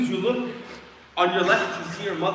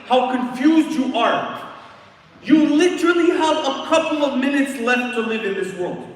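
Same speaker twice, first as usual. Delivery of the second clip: preaching at full force; 6 semitones higher, 8 dB louder than usual. Acted. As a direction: drive it home to the crowd. Preacher building to climax.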